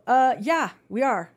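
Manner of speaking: loud, emphatic mock-obvious answer; pointed retort. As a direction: retell it as a loud, of-course comeback. Acted pointed answer to sceptical worker.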